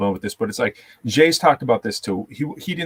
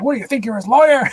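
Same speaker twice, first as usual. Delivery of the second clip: fast, high-pitched mock rebuke; incredulous cop voice, pitched way up; imitated angry cop; 12 semitones higher, 4 dB louder, faster than usual. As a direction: snap it as an irate officer, hammed up. Retold cop rebuking the friend.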